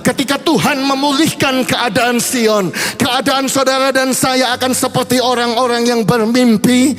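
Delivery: fervent preaching. Acted. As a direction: preach it with growing fire to the congregation. Preacher builds to altar call. (khotbah berapi-api yang makin keras)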